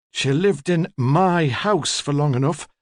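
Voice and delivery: put-on careful, slow emphasis on "my" in a high old woman's voice; stress on MY. A narrator's reading. Old woman on her former lodger, carefully stressing "my". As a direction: lean possessively on the word "my". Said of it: "careful emphasis on the word my".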